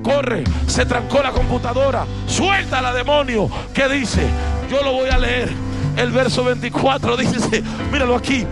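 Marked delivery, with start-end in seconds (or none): none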